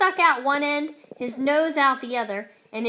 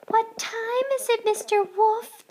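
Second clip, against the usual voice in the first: high, sweet hen voices; lisping chickens; 11 semitones higher, 4 dB louder than usual. A narrator's reading. Chickens coyly asking the wolf. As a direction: ask it coyly like little hens. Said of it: "they lisped".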